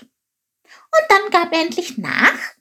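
high, slow, hopeful question; questioning Chilp. A narrator's reading. Chilp asks whether the king gave in, high expectant voice. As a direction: ask it high and drawn out. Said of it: "fragte Chilp".

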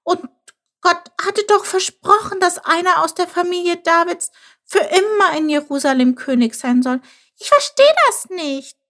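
high, distressed little voice; stammering disbelief. A narrator's reading. Chilp cannot grasp God's broken promise, high and dismayed. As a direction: protest helplessly with the pitch way up. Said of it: "stammelte er".

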